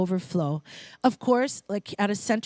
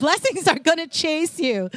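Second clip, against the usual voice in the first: hammed-up country accent, higher pitched; playful bad country twang, pitched up; Joel Osteen; 7 semitones higher, 4 dB louder than usual. Speaker retrying a quote for the congregation, exaggerated country accent. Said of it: "that's not a good accent, but".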